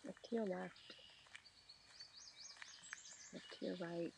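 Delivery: ordinary delivery, nothing marked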